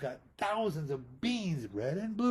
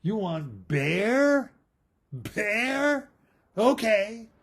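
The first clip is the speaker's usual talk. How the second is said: high, louder mock astonishment; hammed-up shock, higher and louder; mock surprise; 6 semitones higher, 6 dB louder, much slower than usual. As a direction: act amazed they want Bear. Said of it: "Mei Mei says".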